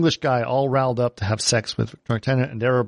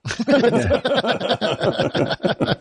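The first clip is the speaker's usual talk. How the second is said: real laugh; 10 semitones higher than usual. Real, not acted. Laughing at the retelling.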